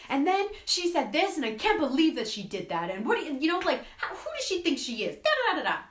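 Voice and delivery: high, loud, indignant ranting; hammed-up outraged gossip; mock outraged gossip. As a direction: vent like you can't believe it. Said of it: "where you're like".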